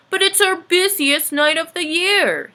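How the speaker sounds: a busy Santa in a fix; worried Santa. Acted.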